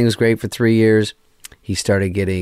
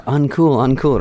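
higher, louder deadpan understatement; mock understatement; 6 semitones higher, 4 dB louder, slower than usual. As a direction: play it absurdly mild while being attacked. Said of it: "I go".

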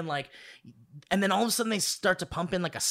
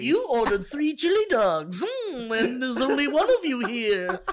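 high, loud teasing waiter; mock-judge the order, pitched up; judgy waiter voice; 8 semitones higher, 5 dB louder than usual. Waiter voiced judging customer.

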